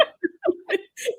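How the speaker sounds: a burst of high, breathy giggling; high-pitched laughing fit; high giggle. Real, not acted. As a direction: giggle helplessly at the joke. Laughing at friend's admission.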